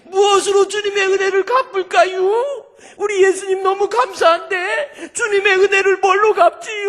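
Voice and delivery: very high, loud crying shout, imitated; acted-out sobbing supplicant at full force; wailing tearful prayer. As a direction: bawl the plea to God. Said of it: "엉엉 울면서".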